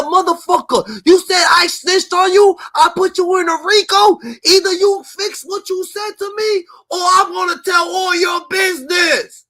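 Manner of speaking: loud, high furious shouting, slowing at the end; imitating furious rant. Imitating Wack threatening a man who called him snitch, shouting. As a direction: yell the threat like you've been called a snitch. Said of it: "He said".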